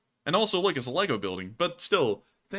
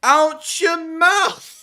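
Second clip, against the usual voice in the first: slow, high, loud shouted movie line; [name]; 13 dB louder, much slower than usual. Retold or imitated. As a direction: bellow it slowly like the Oscars slap. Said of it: "[name] was in that show".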